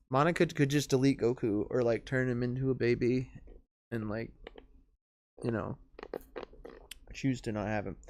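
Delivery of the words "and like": normal talking voice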